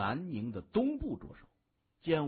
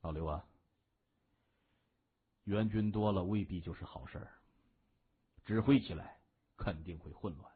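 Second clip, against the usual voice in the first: lower, composed reply to a subordinate; a hint of an unruffled boss, pitched down; lightly voiced gang boss; 7 semitones lower, 3 dB quieter than usual. A narrator's reading. Narrator voices boss reassuring subordinate. (略低沉、从容回应下属的大哥)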